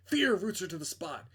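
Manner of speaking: usual speaking voice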